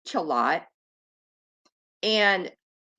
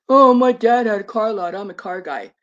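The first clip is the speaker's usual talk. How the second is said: mocking the streamer; 7 dB louder than usual. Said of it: "you say".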